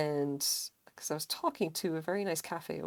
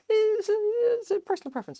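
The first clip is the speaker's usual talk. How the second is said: much higher, sing-song and loud; 9 dB louder than usual. Real, not acted. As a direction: sing it up high, playful.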